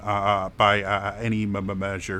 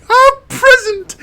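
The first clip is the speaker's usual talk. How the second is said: loud, drawn-out high squeal; thrilled daughter; 12 dB louder, slower than usual. Acted. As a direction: squeal with delight at a gift. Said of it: "She's like".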